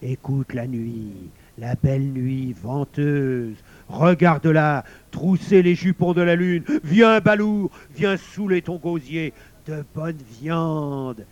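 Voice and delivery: loud calls, easing into slow savouring; growling wolf. A narrator's reading. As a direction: lure a companion out into the night. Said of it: "gronda le loup". (loup qui gronde et entraîne avec gourmandise)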